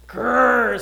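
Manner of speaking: a loud playful growl; rhino growl. A narrator's reading. Storyteller growling as the rhinoceros at Fluffy, loud mock growl. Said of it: "said the rhinoceros".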